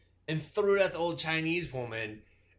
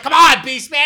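much louder, higher call; mock urging shout; 10 semitones higher, 18 dB louder than usual. Friends retelling a movie, loud mock shout to Beastman. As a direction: yell at Beastman to hurry up. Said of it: "because then they were like".

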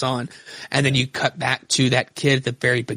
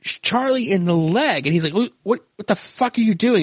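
higher, agitated retelling of the shooting; retold shock; 6 semitones higher than usual. Host retelling Charlie shot by his wife, alarmed. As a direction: tell it worked up, voice climbing.

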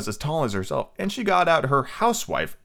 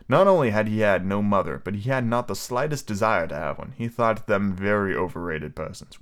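ordinary delivery, nothing marked

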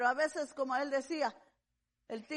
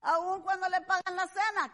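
ordinary delivery

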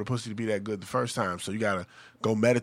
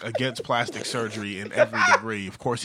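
ordinary talk

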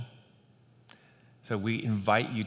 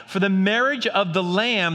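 raised, louder proclaiming read; scripture reading; 7 semitones higher, 4 dB louder than usual. Acted. Pastor reading Revelation to the church, proclaiming. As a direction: proclaim the text like a herald.

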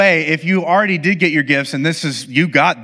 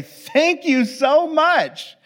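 high and drawn out slowly; mock gratitude; 11 semitones higher, much slower than usual. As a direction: overdo the sweet thank-you. Mock gracious response to insult gift.

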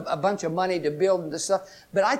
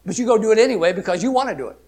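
fast; 3 semitones higher, 5 dB louder, much faster than usual. Real, not acted. Fast scolding aside.

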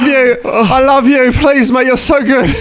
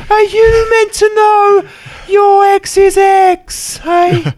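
put-on drunken shout; 9 semitones higher, slower than usual. Drunk man shouting at rival.

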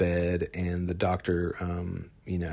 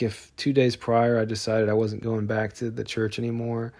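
ordinary talk

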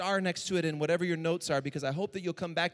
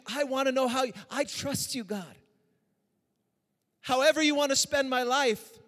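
pleading prayer; 6 semitones higher than usual. Retold or imitated. Pastor reliving his surrender to God, raised and pleading. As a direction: cry out to God, holding nothing back.

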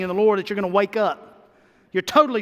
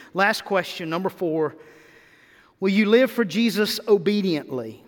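usual speaking voice

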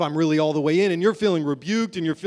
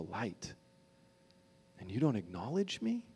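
tender voice of Jesus; 10 semitones lower, 12 dB quieter than usual. Acted. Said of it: "And Jesus says".

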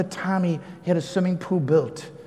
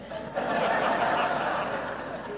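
breathy laugh; 7 dB quieter than usual. Real, not acted. Laugh after own retort.

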